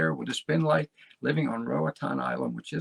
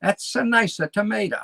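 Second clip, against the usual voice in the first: quick mock Italian accent, higher and louder; 8 semitones higher, 7 dB louder than usual. Showing how Uruguayans speak Spanish, playful Italian accent. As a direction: ham up an Italian accent on the words. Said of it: "They speak Spanish with an Italian accent".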